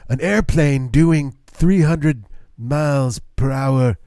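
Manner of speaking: put-on slow, halting old-president ramble; lightly voiced Reagan losing his thread, slow; rambling Reagan impression. Mocking an elderly Reagan rambling about cars and planes, slow. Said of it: "that was Reagan towards the end".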